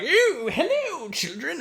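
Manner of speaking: put-on a villain luring kids sweetly; creepy greeting. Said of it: "it's like".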